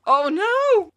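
high hammy gasp of alarm; mock shock. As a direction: play the dismayed patient, overdone. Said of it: "I'm trying to react here".

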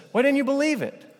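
pitched well up, exasperated question; Jesus asking his disciples why they doubted, pitched high. Retold or imitated. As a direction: plead with disbelieving friends. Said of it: "Jesus says".

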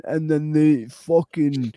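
slow, drawn-out Ned imitation; mock Ned drawl. Shown as a demonstration. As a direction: drag it out like a Scottish Ned. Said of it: "speak like this".

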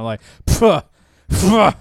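scoffing puff of air; silenced gunshot. Retold or imitated. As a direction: blow out a dismissive pfuh. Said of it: "Thank you for showing us examples of what's scuffing us".

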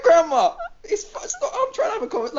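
high-pitched retold exasperation; exasperated hushing. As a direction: imitate fending off a nagging grandma. Retells pleading with grandma while phoning.

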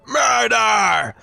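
high desperate scream. A narrator's reading. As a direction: scream it out in panic. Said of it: "screamed the man repeatedly".

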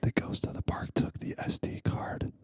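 hushed spooky whisper. Pretend. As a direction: whisper right into the mic. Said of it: "I have to whisper right into the mic".

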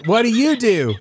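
high, eager voice of a confused patient; Mark's eager greeting. Retold or imitated. Patient greeting a stranger in the parking lot, high and eager. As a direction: pitch it up like an overfriendly stranger. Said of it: "so he goes".

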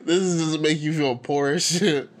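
laughing. Real, not acted. Laughing at rich player's photo.

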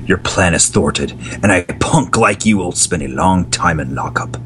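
low stern hero voice; gruff hero. Acted. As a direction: growl it like a vigilante. Mystery man confronts villain.

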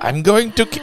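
put-on high, garbled part-German accent; botched German accent. Trying out accents for cohost, botched German accent. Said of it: "That was a part German".

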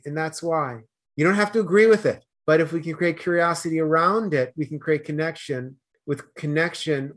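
usual speaking voice

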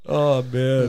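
ordinary talk, nothing marked

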